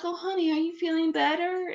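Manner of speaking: sleepy sweet wife. Retold or imitated. Half-asleep wife asking her sick husband, lightly sleepy sweet. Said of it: "In my sleep, I was like".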